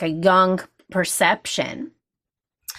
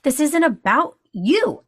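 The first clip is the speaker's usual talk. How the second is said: retold outburst; 6 semitones higher, slower than usual. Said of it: "I lost my poop".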